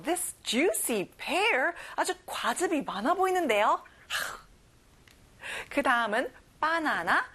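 ordinary talk, nothing marked

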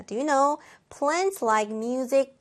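ordinary talk, nothing marked

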